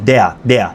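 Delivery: "Der" low and slow as "dea". Shown as a demonstration. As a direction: say "Der" slowly, r turned into a. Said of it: "e r olunca a diye okunur".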